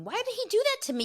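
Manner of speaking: playful high whine; mock whine. Real, not acted. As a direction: complain like a wronged diva. Mock outrage at Lance.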